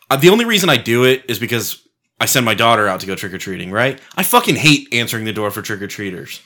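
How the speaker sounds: usual speaking voice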